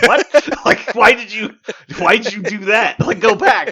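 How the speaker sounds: loud, high, laughing disbelief; exasperated laughing outburst. Retold or imitated. Cracking up at a friend's silly miniatures pitch, loud and incredulous. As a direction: blurt it out laughing at the absurdity.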